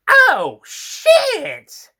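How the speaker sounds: very high, loud last cry; panicked flytrap. Acted. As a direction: shriek it as you burst. Flytrap about to explode.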